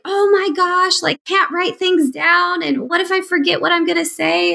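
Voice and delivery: high, panicked rush of worry; freaking out. Retold or imitated. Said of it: "I would be freaking out".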